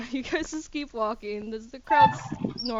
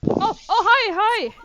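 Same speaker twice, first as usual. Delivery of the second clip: higher and louder bright call; excited greeting; 12 semitones higher, 14 dB louder than usual. Acted. Darius spots old man Nestor outside the shop, higher louder excited call. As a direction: call out happily across the market.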